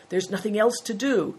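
ordinary delivery

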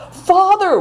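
high, frightened cry for her father; wailing. A narrator's reading. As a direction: call out in sudden fear, voice high. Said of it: "wailed Seven Stars".

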